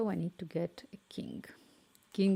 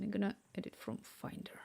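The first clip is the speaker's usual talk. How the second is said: whisper; 8 dB quieter than usual. Real, not acted. Whispering so the cat can sleep.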